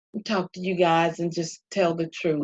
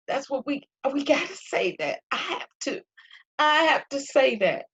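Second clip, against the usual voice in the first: higher preaching voice, pressing the point; 6 semitones higher than usual. Acted. Preaching to Pastor Faye and viewers about telling truth. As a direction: drive the lesson home like a sermon.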